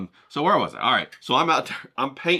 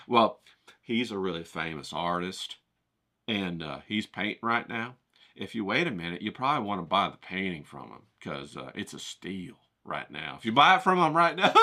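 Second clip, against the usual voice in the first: much lower, softer salesman croon; smooth cameraman; 7 semitones lower, 4 dB quieter than usual. Retold or imitated. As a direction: sell it smoothly and hushed. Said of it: "My cameraman goes".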